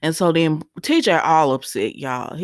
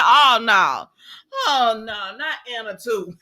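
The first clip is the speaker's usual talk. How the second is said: dismayed cry; 4 semitones higher than usual. Real, not acted. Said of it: "I said".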